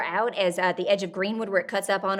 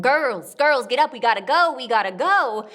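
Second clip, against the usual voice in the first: urgent loud holler, pitched up; 4 semitones higher, 9 dB louder than usual. Acted. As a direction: holler like a father rousing his girls. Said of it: "he is hollering".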